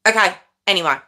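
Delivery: ordinary delivery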